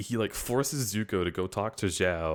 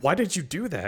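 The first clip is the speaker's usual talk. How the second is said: high, whiny mock complaint; whiny hurt question; 9 semitones higher than usual. Sokka whining at Suki after being kicked, high and hurt. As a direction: whine it like a wounded kid. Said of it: "and he's like".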